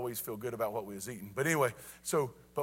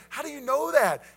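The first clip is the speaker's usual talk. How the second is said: high, loud, incredulous yelp; hammed-up alarmed retort; panicked son; 12 semitones higher, 9 dB louder than usual. Panicked son answers calm dad.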